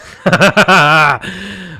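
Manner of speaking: loud real burst of laughter. Real, not acted. Remembering mocking classmates, loud real laugh. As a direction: belly laugh at a school memory. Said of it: "laughing at school memory".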